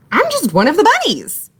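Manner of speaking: high, eager, slightly too loud; a little too loud. A narrator's reading. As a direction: blurt it a bit too eagerly. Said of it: "a little too loudly".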